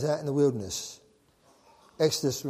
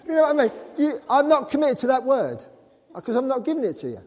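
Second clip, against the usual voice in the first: higher and louder, laughing it off; jokey raised voice; laughing excitement; 9 semitones higher, 9 dB louder, faster than usual. Real, not acted. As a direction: push it up with amusement. Joking about not giving money.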